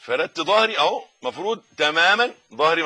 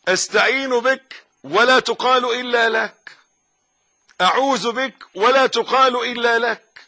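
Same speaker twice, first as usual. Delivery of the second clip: loud, slow, high preaching cadence; drawn-out sermon shout; forceful preaching; 6 semitones higher, 6 dB louder, slower than usual. Acted. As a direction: proclaim each phrase to the congregation. Preacher reciting formula forcefully.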